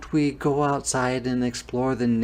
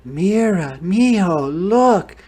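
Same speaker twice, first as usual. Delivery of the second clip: high, loud, swooping calls; thrilled look-at-this shout; excited mother calling; 8 semitones higher, 9 dB louder, slower than usual. Acted. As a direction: call out each word to wake a child. Mother excitedly wakes sleeping son.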